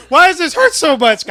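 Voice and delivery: high-pitched pained hollering; retold screaming. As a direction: wail it loudly, replaying the pain. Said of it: "I screamed my ass off afterwards".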